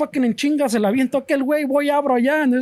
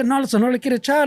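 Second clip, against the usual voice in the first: ordinary talk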